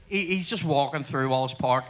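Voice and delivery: ordinary talk, nothing marked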